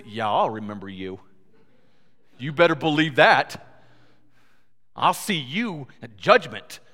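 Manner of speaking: loud, punchy vow of payback; acted vindictive comeback; mock threatening retort. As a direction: throw it back as a menacing promise. Acts his own vengeful reply.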